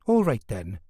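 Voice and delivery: plain reading voice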